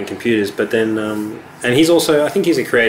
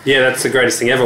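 normal talking voice

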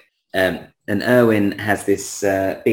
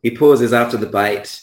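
ordinary talk

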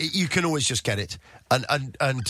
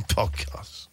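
scoffing banker; 2 semitones lower, 5 dB quieter than usual. Retold or imitated.

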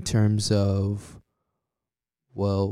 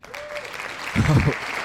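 hearty laugh; 3 dB louder than usual. Real, not acted. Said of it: "thank you, thank you".

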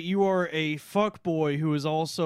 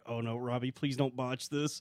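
nervous inner thought; 8 semitones lower, 10 dB quieter than usual. Retold or imitated. Inner panic at friend.

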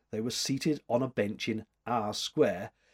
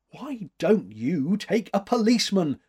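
higher, louder sudden outburst; blurting out; 7 semitones higher, 5 dB louder than usual. A narrator's reading. Flustered man worried for her safety, blurting. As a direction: blurt it with raised pitch. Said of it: "he blurted out".

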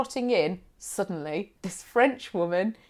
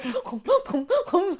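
mock nervous humming; 9 semitones higher, slower than usual. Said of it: "like this".

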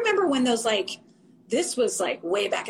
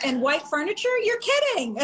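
mock disbelief; 8 semitones higher than usual. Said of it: "and you're like".

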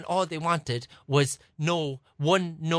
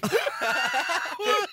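high burst of laughter; real laughter; 12 semitones higher than usual. Real, not acted. Telling a friend about the plug mix-up, laughing.

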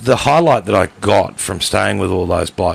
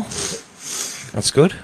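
ordinary delivery

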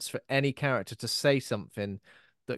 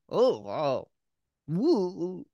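mock wowed; 5 semitones higher, much slower than usual. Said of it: "made me feel a bit".

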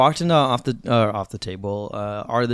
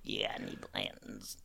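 mock low croak from the throat, much softer; croaky throat voice; 3 semitones lower, 22 dB quieter, slower than usual. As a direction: imitate bourbon guys croaking their order from the throat. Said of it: "they have their thing in the throat".

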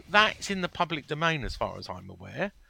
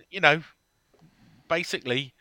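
ordinary talk

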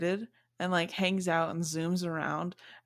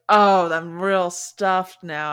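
stuffed cat; 10 dB louder than usual. Retold or imitated. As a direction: sigh it out like an overfed cat. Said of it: "she's like".

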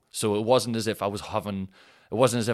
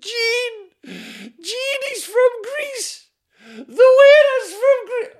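squeaky, much higher and slow wailing whine; silly high whiny voice; 12 dB louder, much slower than usual. Pretend. Mock wail complaining to Gene about the Greek waiter. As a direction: wail it out like a crybaby. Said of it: "I love doing that voice".

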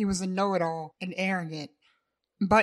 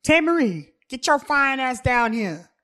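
loud, much higher call up the stairs; 6 semitones higher, 9 dB louder than usual. Acted. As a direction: holler up the stairs to her. Davon calls upstairs.

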